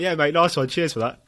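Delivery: nervous jolly dismissal; awkward brush-off. Retold or imitated. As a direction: cover the awkwardness with fake cheer. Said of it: "you're just like".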